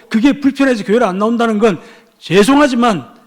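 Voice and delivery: loud, high sermon delivery; impassioned preaching. Real, not acted. Preacher challenges congregation over petty parking complaints, impassioned preaching. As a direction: drive the argument home at full force. Preacher argues at full force.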